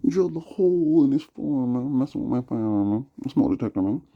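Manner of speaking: high, loud put-on ranting voice; hammed-up high-pitched complaint; mock rant. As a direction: yell the grievances up high. Ranting about neighbor's drilling.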